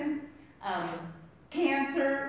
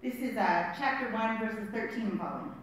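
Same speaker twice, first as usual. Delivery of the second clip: ordinary talk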